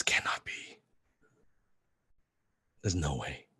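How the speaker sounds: breathless whisper of disbelief; whispered disbelief to himself; stunned whisper. Retold or imitated. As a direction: barely voice it, like you can't believe your eyes. Stunned disbelief at seeing his idol.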